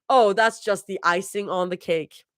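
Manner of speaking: plain reading voice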